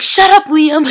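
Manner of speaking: exasperated outburst. Retold or imitated. Joe shutting Liam up, retold as a loud outburst. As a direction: cut him off loudly.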